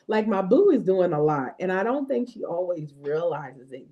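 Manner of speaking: ordinary talk, nothing marked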